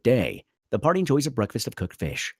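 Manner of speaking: normal talking voice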